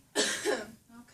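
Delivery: one deliberate chesty cough; forced cough. Acted. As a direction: cough hard on the out-breath. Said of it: "cough on your breath out for me".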